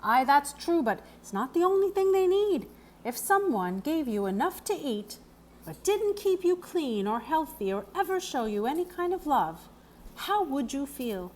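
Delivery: a hint of a kindly old stableman; the groom explaining the pony's hooves, lightly voiced; Mr Grimes. A narrator's reading.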